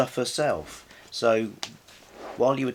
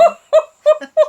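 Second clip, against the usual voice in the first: a loud, high burst of laughter; 15 dB louder than usual. Real, not acted. Host bursting into loud laughter at the end. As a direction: let out a big squealing laugh.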